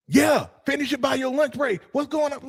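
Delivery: sharp exasperated yell, played up; annoyed boss. Boss snapping at staff over the late report. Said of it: "You're like".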